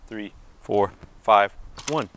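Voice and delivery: counting out the beats in time with each swing; counting the rhythm. Shown as a demonstration. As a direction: call each count as the ball comes back, landing on five. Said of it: "The first way is to hit on, uh, six".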